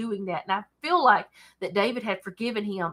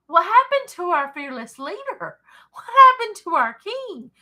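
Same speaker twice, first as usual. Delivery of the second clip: surprised; 9 semitones higher, 6 dB louder than usual. Acted. Israel asking about their missing leader.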